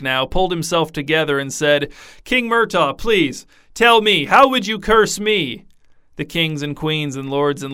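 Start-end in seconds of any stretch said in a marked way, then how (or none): 2.2-5.6 a laughing plea; king pleading for the joke, higher; amused king, higher. A narrator's reading. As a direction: beg the answer through mirth. King Carrick asks laughing.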